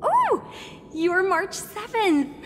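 high, drawn-out exclamation of recognition; a high "Oh!", then stretch the name out; excited recognition. Acted. Reporter spots the stand-in she wants to interview, excited recognition.

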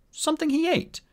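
very high, light answer; high-pitched, innocent-sounding reply; Artemis's reply. Acted. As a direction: offer it up lightly.

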